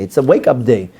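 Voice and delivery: normal talking voice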